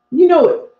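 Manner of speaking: emphatic callout. Real, not acted. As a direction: hit it hard like a rallying cry. Emphatic repeat of a rallying line.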